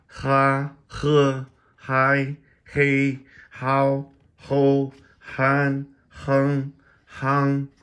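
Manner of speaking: each syllable called out loud and high, slowly spaced; drilling syllables. Shown as a demonstration. Teacher drilling Chinese initials for viewers, loud high enunciation. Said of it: "My voice is going".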